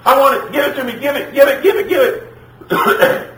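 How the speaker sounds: put-on very high, insistent kid whine; screaming tantrum child. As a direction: play a toddler throwing a fit, repeating the demand. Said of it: "starts screaming".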